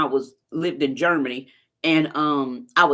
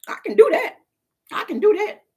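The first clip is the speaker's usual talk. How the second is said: mock hype; 4 dB louder than usual. Real, not acted. Hyping herself up after swallowing.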